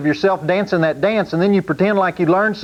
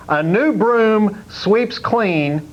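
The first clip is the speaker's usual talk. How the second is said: mock slow slogan; 2 semitones higher, much slower than usual. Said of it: "he talks about".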